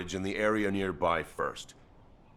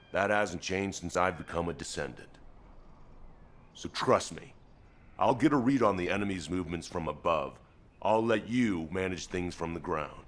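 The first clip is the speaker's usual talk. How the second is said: ordinary talk, nothing marked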